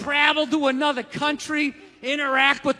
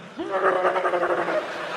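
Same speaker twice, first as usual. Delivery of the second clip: imitated dog growl; 6 semitones lower than usual. Dog latching onto his face.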